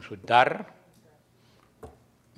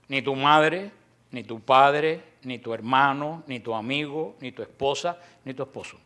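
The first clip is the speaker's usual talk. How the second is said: ordinary talk, nothing marked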